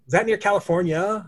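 California with a pointedly hard open A at the end, no R; hard final A. Retold or imitated. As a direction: mockingly land on the last A of California. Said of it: "I just hard at that A".

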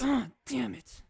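mock frustration. Real, not acted. Told he can't change list.

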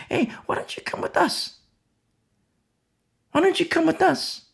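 high, whispered invite. Retold or imitated. A believer inviting old friends to church, in a high whisper. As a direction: coax them in a whisper.

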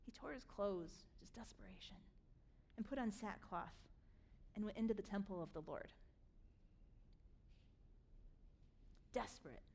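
low, soft, solemn narration; hushed desperation. A narrator's reading. Preacher telling of Hezekiah tearing his clothes, hushed and grave. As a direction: let it sink quietly, with long pauses. Preacher dwells on the king's despair.

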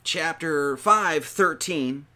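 ordinary talk, nothing marked

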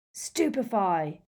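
slow, drawn-out spell cry; bellowed spell. A narrator's reading. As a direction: bellow the incantation, stretching it out. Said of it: "he bellowed".

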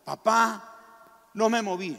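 high, loud tearful cry to his father; a little boy sobbing out his relief; crying child. Retold or imitated. Boy crying to his father after the battle.

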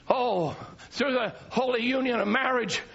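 usual speaking voice

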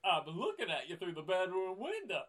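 loud, high, jittery comic voice; hammed-up nervous sitcom actor; squeaky, agitated impression at full volume; Don Knotts. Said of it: "Is this Don Knotts?".

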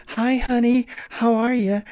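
loud, very high falsetto woman. Shown as a demonstration. Narrator showing what to avoid for women's parts, falsetto female caricature. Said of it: "avoid the stereotypes".

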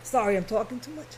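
hoarse, fading voice, quick; strained voice after coughing; losing voice. Real, not acted. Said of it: "I lost my voice".

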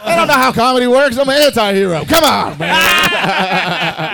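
loud, high comic protest; hammed-up exasperated outburst; mock-indignant shout. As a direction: yell in playful self-defence. Mock protest at critique of story.

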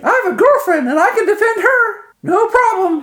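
squeaky falsetto muscleman; very high, loud jock voice; high-pitched voice. Acted. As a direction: greet the crowd in a very high falsetto. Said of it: "says in a high-pitched voice".

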